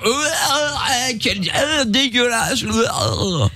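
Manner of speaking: high, slow giggly stammer; mocking laughing disgust. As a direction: imitate a laughing, disgusted listener. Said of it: "je pensais que t'allais dire".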